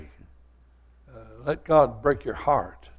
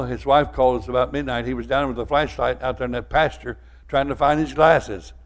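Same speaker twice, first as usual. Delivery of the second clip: ordinary talk, nothing marked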